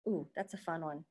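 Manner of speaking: ordinary talk, nothing marked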